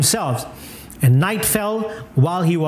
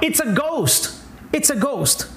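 frightened cry; 5 semitones higher than usual. Acted. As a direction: cry out like scared disciples, lightly acted. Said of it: "in their fear, they cried out".

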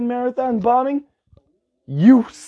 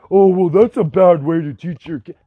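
mocking voice of a critic; imagined objector. Ranter voicing what viewers probably think, mocking objector. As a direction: put on a mock objector's voice. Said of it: "what you all are probably thinking".

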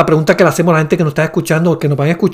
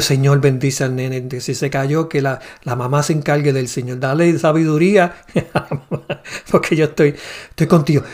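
softer, murmured mock prayer; hushed prayer; 3 semitones lower, 7 dB quieter than usual. Praying for the fallen child while staying with God, hushed. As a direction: pray quietly under your breath.

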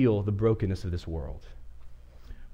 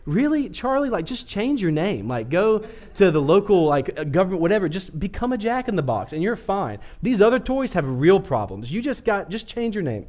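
exasperated retort; 7 semitones higher than usual. Retold or imitated. Scolding the Jack-in-the-Box about his name, exasperated and incredulous. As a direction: roll your eyes and lecture the toy in disbelief. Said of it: "And I'm like".

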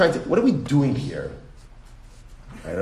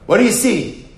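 pitched higher and louder, pressing the class; final insistent take, demanding an answer; emphatic question; 8 semitones higher, 6 dB louder than usual. Shown as a demonstration. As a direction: push the question home to the room. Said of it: "repeated question building up".

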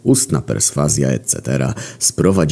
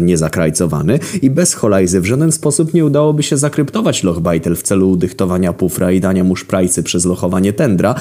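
ordinary delivery

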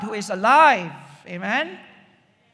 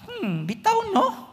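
much higher, musing "Hmm, bitaw no?"; wondering Eve; 12 semitones higher than usual. Retold or imitated. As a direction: toy with the doubt as it creeps in. Eve musing over the devil's doubt.

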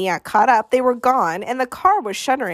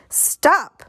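higher, sharp command to stop; contained yell, pitched up; narrated yell; 7 semitones higher than usual. A narrator's reading. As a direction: call a halt, voice raised in pitch. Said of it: "Maniac finally yelled".

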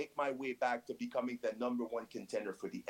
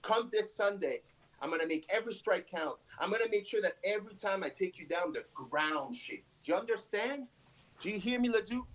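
angry; 6 semitones higher than usual. Acted. Heated promo threat at rival.

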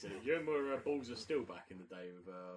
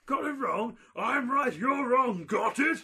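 much higher, louder indignant yelling; mock fury; 15 dB louder, slower than usual. Contestant yelling back at the quiz host, furious shout. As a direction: scream back in outrage.

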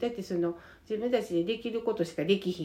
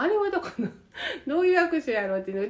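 laughing; 8 semitones higher, 3 dB louder, much faster than usual. Real, not acted. Amused at odd handshakes.

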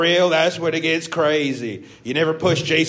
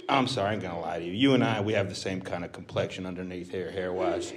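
fast, lower, softer backpedal; rushed quiet aside; 7 semitones lower, 7 dB quieter than usual. Real, not acted. Breaking character with a fast, soft apology to the crowd. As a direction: mumble it out quickly. Dropping act, quick aside.

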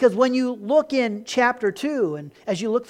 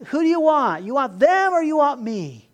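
demanding Jonah; 7 semitones higher, 6 dB louder than usual. Retold or imitated. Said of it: "it's like".

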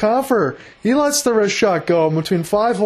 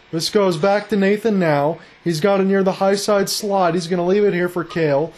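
normal talking voice

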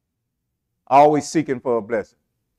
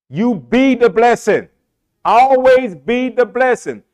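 full-force preaching; 5 semitones higher, 4 dB louder than usual. Acted. Preacher exhorting his congregation, full-force preaching. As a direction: drive the message home to the church.

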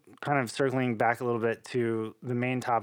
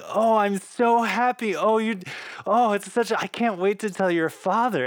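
tearful joy; 8 semitones higher, 4 dB louder than usual. Retold or imitated. Mom in the car, almost crying with joy at the news. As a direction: imitate mom choking up with happiness. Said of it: "tearing up and almost crying".

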